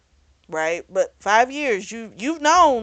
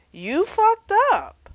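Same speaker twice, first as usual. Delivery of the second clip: high, slow, drawn-out scolding; calling her out; 11 semitones higher, 5 dB louder, slower than usual. Retold or imitated. Viewer telling the mother off for hiding the baby, slow and scolding. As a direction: lay it on her, no sympathy. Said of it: "I'm like".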